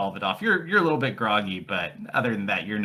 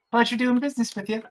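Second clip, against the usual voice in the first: high-pitched innkeeper; high, polite business sign-off; innkeeper character voice; 13 semitones higher than usual. Acted. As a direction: thank the customer in a high put-on voice. Game master voices the innkeeper.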